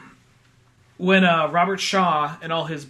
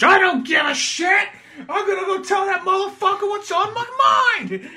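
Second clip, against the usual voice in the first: high, loud, laughing rant; hammed-up New York defiance; mock defiant shout; 13 semitones higher, 4 dB louder than usual. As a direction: yell it like a man who's had enough. Voicing Kaz storming off.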